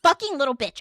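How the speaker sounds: acted-out heated outburst. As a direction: retell fuming about someone. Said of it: "I'm heated 'cause I'm just like".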